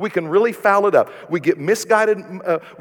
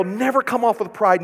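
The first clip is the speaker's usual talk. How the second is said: usual speaking voice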